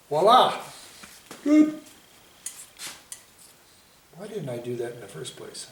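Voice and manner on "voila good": delighted exclamation. Real, not acted. Painter to viewers, pleased with his accent stroke, delighted high shout. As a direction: burst out high and loud with delight.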